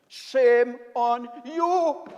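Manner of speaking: scolding. Real, not acted. Scolding the government side.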